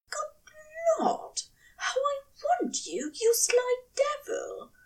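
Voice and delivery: high breathy whisper into his neck; breathed. A narrator's reading. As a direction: breathe it out with longing. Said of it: "Aziraphale breathed into his neck".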